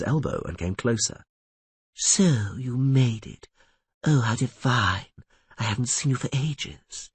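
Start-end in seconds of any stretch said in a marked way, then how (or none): 1.9-7.1 slow, falsely sweet sorceress; sugary greeting. A narrator's reading. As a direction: drawl out fake delight.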